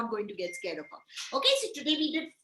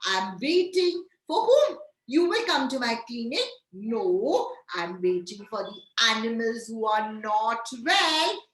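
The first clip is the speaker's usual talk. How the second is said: usual speaking voice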